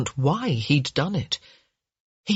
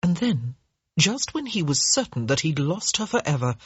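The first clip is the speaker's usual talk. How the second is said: ordinary delivery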